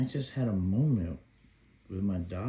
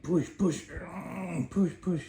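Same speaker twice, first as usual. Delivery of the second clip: higher, eager push chant; dad cheering his baby on, much higher; 9 semitones higher than usual. Retold or imitated. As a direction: cheer her on, pitch rising.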